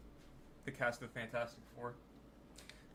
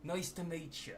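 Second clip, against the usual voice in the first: put-on cheeky Cockney-ish British accent, a bit higher; mock British accent; 4 semitones higher than usual. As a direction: play a chirpy Brit saying hi. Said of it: "Lucy's accent".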